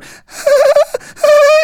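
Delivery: loud, high cackle; piercing staccato mock giggle; imitated laughter. Laughing coming from the corner, loud high cackle. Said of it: "he hears the laughing".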